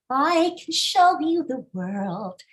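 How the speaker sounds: high, slow, pinched Disney singing; Disney character voice. Shown as a demonstration. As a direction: sing it like a Disney prince. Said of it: "think of a Disney character".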